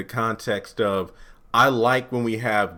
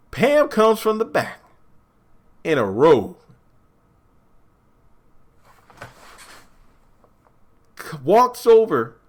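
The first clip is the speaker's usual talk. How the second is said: excited recap; 9 semitones higher, 4 dB louder than usual. Real, not acted. Dramatic recap of reveal.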